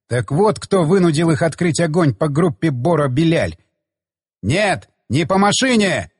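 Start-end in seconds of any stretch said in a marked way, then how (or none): none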